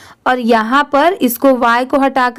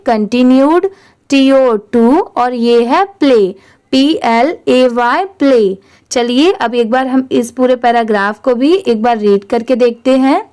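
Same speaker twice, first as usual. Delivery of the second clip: normal talking voice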